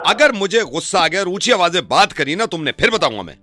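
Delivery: loud threat, pitch dropping at the end; shouting. Pretend. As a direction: warn forcefully. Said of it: "चिल्लाए तो घुस जा रहा है तू".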